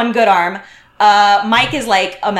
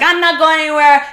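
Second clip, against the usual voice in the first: higher-pitched imitation of a stubborn drunk; mock defiance; 6 semitones higher, 3 dB louder than usual. Drunk guest refusing to leave the room, mock defiant. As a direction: imitate her digging in, pitched up.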